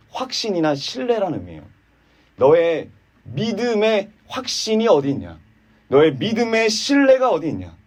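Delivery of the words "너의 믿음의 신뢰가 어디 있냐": ordinary delivery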